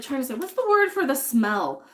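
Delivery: ordinary delivery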